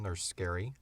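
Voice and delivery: ordinary talk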